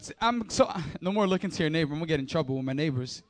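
ordinary talk

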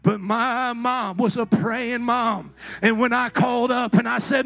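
loud, ringing preacher's cry, slowing for weight; preaching at full force. Acted. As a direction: preach it out to the whole church.

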